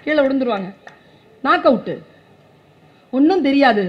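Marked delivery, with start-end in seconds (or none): none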